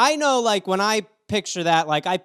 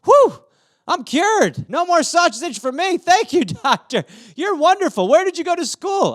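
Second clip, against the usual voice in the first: put-on high-pitched, louder gushing kid voice; 11 semitones higher, 4 dB louder than usual. Kid gushing to the doctor, high and excited. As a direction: squeal thanks like an amazed kid. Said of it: "He's like".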